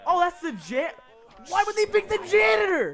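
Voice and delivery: high, loud mock outrage; overacted anime dub. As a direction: yell it in disbelief. Outraged reaction to character pick.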